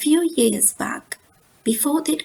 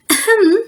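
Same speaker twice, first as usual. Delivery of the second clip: higher, louder pointed ahem; Mama demanding Papa's attention; pointed throat clear; 10 semitones higher, 7 dB louder than usual. Acted. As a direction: clear your throat to get his attention. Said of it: "said Mama".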